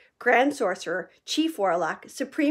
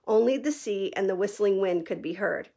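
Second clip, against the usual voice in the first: plain reading voice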